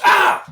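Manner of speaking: sudden high, loud "ah"; mock startled yell. Imitating a cameraman out of shot, sudden mock yell. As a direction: cry out as if hurt, imitating.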